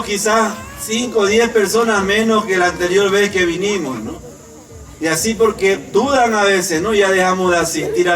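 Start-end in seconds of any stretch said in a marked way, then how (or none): none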